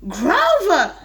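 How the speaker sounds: loud, sharp warning call; scolding shout. Acted. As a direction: cut him off with a shout. Mother scolds Grover.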